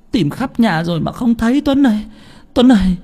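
high, wailing plea; crying. A narrator's reading. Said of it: "Chị Bảy khóc lóc". (khóc lóc van nài, giọng cao)

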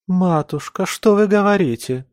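high, shocked plea; horrified. A narrator's reading. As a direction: gasp it out in horror. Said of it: "с ужасом посмотрела".

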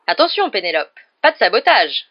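loud, teasing mock warning; amused. A narrator's reading. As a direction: warn playfully, lifting the voice at the end. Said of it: "d'un ton amusé".

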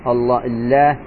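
the lam in الله doubled and held. Shown as a demonstration. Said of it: "اسم الجلالة فيها مشدد". (الله بتشديد اللام)

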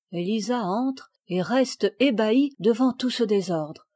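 ordinary delivery, nothing marked

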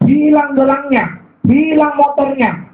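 preacher hammering each loss home; slow emphatic list. Acted. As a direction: stretch each loss out for the crowd. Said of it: "listing losses with emphasis".

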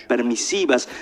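normal talking voice

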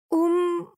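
high-pitched queasy groan; sick, drawn-out high "mm"; nauseous moan. A narrator's reading. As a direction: moan through closed lips. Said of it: "застонала Лизавета".

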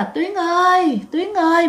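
high, drawn-out call of a name; retold calling out. Mother calling her missing daughter, retold high call. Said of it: "Mẹ của chị kêu rằng".

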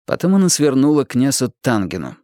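ordinary delivery, nothing marked

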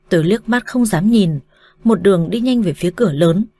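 ordinary delivery, nothing marked

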